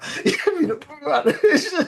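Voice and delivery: high, loud burst of laughter; sudden amused laugh. Real, not acted. Laughing at the pub image.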